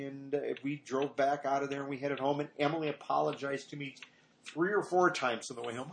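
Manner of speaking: normal talking voice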